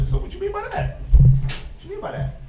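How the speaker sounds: hammed-up high quizzing; high mock question. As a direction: pitch it way up in mock puzzlement. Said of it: "And I asked him".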